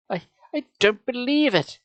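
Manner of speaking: high, stammering disbelief. A narrator's reading. Carly Beth in disbelief.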